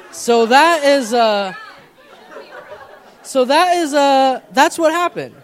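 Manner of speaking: can't get the words out for laughing. Real, not acted. A pastor laughing at his own confession to the congregation.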